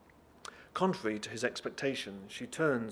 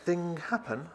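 higher, halting question; puzzled servant's broken English; 6 semitones higher, slower than usual. Acted. Servant with teapot asks Jacob, puzzled broken English. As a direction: innocent accented enquiry, pitched up.

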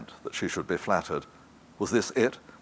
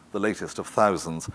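ordinary delivery

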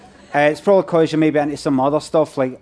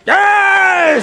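high, loud, drawn-out yell; triumphant shout; 13 semitones higher, 5 dB louder than usual. Acted. Comedian answering a crowd request, long shouted catchphrase. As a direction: hold the word in a big raised shout.

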